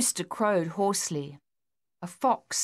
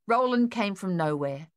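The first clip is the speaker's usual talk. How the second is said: plain reading voice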